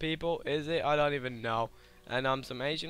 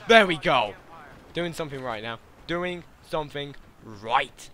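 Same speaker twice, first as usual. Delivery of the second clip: normal talking voice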